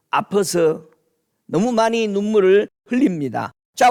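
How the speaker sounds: usual speaking voice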